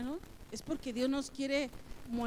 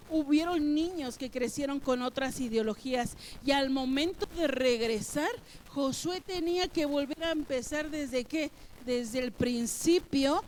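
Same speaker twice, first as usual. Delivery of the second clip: louder, driving sermon voice; fervent preaching; 5 dB louder than usual. Acted. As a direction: preach it to the congregation with rising force.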